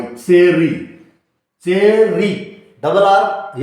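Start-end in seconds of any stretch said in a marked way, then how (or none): 0.0-2.3 चेरी with the r held longer; long r in cherry. Shown as a demonstration. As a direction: stretch the r in चेरी. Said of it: "आर जहां थोड़ा लंबा निकलता है".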